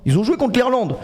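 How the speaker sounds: ordinary talk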